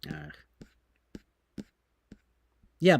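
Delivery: normal talking voice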